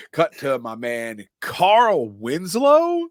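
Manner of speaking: high, drawn-out, rising hype; excited slow announcement. Real, not acted. Introducing Carl Winslow to friends, high drawn-out hype. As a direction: stretch it out like a big reveal. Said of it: "stretched excited intro".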